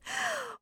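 sharp, high intake of breath; delighted gasp. A narrator's reading. Wonka learning Charlie is last, gasping in delight. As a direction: gasp in happy amazement.

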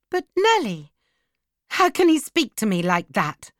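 high, wounded protest; indignant cry. A narrator's reading. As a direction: appeal to her in outrage. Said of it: "she cried".

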